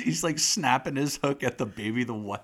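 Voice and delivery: usual speaking voice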